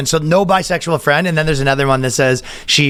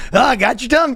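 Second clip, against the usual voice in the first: goofy high jokey voice; mock goofy jokester; 10 semitones higher than usual. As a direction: play the corny office jokester. Unfunny guy doing tie gag.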